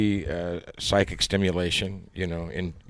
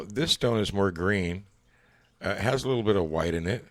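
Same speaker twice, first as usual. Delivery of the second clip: ordinary delivery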